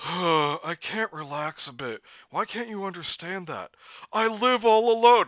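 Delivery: rising, high, loud melodrama; dramatic anime voice. Acted. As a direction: overact the anime lament. Dramatic anime character reading.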